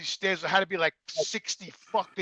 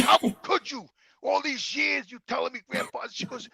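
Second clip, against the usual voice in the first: higher, hurt and furious; angry accusation; 8 semitones higher than usual. Retold or imitated. Son blames mother for hiding grandpa, angry. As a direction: rage at the years lost.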